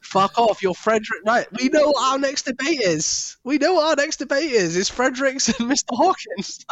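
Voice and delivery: high, giggly voice breaking into laughter; laughing while announcing the next debate. Real, not acted. To his co-host, laughing about the ice cream debate.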